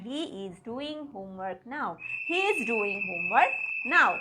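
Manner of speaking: slow, word by word; broken into words. Shown as a demonstration. Teacher breaking the sentence word by word for students. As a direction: lay out each word with gaps. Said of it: "ভেঙে ভেঙে বললাম".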